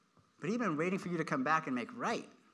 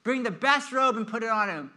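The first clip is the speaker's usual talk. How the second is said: urgent father's orders; 5 dB louder than usual. Acted. Father telling servants to dress his returned son, urgent and excited. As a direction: rattle off orders for a celebration. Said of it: "the father said to his servants, "Quick".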